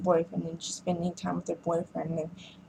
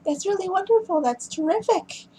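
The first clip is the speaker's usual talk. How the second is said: high-pitched sarcastic imitation; pitched way up with fake gratitude; mock pissy; 13 semitones higher, 3 dB louder than usual. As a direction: imitate a huffy daughter thanking her dad. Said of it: "her getting all pissy afterwards".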